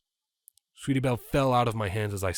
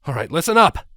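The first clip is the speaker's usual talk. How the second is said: loud, higher commanding call; 6 semitones higher, 9 dB louder than usual. Acted. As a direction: take charge of the group. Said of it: "I announced".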